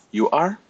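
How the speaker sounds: You are said with are rising high as a question. Shown as a demonstration. Teacher shows students a question, are rising. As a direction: ask it with a lifting are. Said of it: "to ask someone directly a question".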